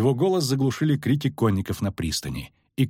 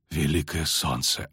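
low, slow amazement; hushed gasp of awe; 8 semitones lower, 4 dB quieter, slower than usual. A narrator's reading. Knight stunned by the sword feat, hushed awe. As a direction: marvel under your breath, pitch dropped. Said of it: "ахнул Кагыр".

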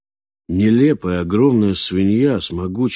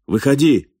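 raised voice, pitched up sharply; loud call; 5 semitones higher, 4 dB louder than usual. A narrator's reading. Falk orders the hidden stranger out, loud shout. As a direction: call out loudly from cover. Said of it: "громко крикнул".

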